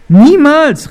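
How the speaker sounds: loud, high cry of refusal; calling out. A narrator's reading. Jonathan rejects betraying his friend David, calling out. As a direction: shout the denial in one burst. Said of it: "rief Jonathan".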